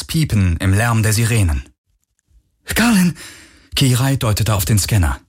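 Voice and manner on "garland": alarmed call. A narrator's reading. Alarm beeping, she calls out.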